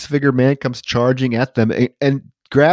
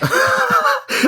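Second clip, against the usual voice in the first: high laugh. Real, not acted. Laughs after mock line.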